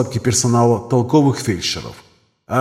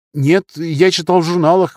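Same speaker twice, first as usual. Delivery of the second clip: higher-pitched junior doctor answering; young surgeon; 6 semitones higher, 4 dB louder than usual. Acted. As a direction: answer back in a raised, youthful pitch. (молодой хирург, высоким голосом)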